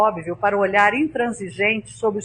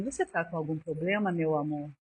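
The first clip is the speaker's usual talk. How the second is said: soft, low and kind, a touch of sweetness; tender concern; 7 semitones lower, 8 dB quieter than usual. Retold or imitated. Customer to rude bakery clerk, soft tender concern. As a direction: disarm her with quiet tenderness.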